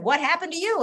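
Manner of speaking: a teacher asking in front of class; imitating a teacher asking a question in front of the class; curious teacher. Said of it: "my teacher said".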